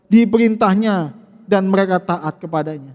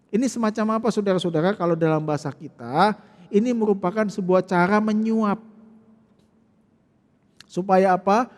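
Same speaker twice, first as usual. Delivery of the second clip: ordinary delivery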